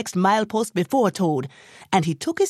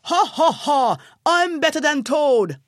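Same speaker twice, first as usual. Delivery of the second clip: loud high braying boast; donkey bawling at the milepost; bawled boast; 8 semitones higher, 3 dB louder than usual. A narrator's reading. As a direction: crow it out in triumph. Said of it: "he bawled out".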